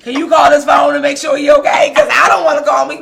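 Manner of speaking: loud, high, urgent asking; worried mother urging her own mother to call; frantic plea. Retold or imitated. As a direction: beg her fast before panic sets in. Frantic mom asking grandmother.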